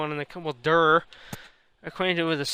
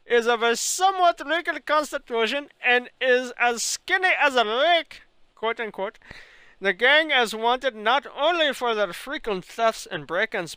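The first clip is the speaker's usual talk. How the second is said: put-on Indian newsreader, higher and brighter; Indian accent; 4 semitones higher than usual. Streamer finishing the newspaper in a mock Indian accent. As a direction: ham up an Indian newsreader to the sign-off. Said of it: "doing the Indian thing".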